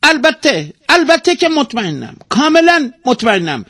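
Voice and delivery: very high, loud, emphatic bird voice; firm, high-pitched parrot assurance; decisive parrot. A narrator's reading. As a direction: squawk it with total certainty. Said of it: "توتی با قاطعیت گفت".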